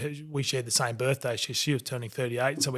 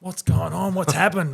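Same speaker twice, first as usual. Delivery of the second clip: higher, louder voice of a puzzled wife; mock-alarmed questions, pitched up; wife's alarmed questioning; 5 semitones higher, 5 dB louder than usual. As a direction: lightly imitate a wife demanding answers. Voices his startled wife in the story.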